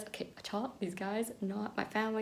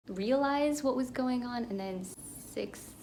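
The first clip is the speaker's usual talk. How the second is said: usual speaking voice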